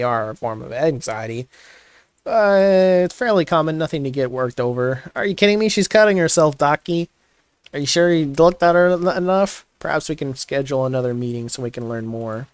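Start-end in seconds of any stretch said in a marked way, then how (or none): 5.2-9.7 indignant outburst. Acted. Upset at doctor dismissing self-harm.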